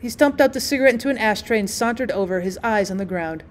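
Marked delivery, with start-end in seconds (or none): none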